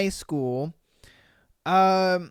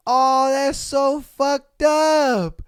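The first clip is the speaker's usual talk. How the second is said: appalled drawl; 5 semitones higher, 3 dB louder, much slower than usual. Real, not acted. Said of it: "reacting to cruel prank".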